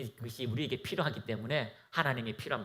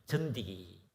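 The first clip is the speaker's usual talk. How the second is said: 전디기 said low and soft in Gyeongsang dialect; Gyeongsang dialect word; 8 semitones lower, 5 dB quieter, slower than usual. Shown as a demonstration. Preacher showing a Gyeongsang word to the congregation, in low Gyeongsang dialect. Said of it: "경상도 아주 사투리 얘기하면".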